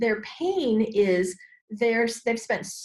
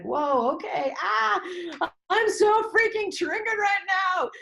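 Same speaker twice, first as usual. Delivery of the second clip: freaked-out outburst; 8 semitones higher than usual. Retold or imitated. Coach acting out a triggered moment, mock panicked shout. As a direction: blurt it out like your nerves just snapped. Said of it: "to be like".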